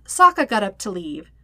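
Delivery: plain reading voice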